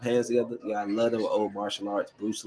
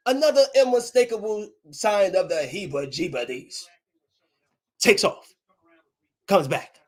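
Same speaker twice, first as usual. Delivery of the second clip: lightly voiced Grinch; 8 semitones higher than usual. Grinch scene recited.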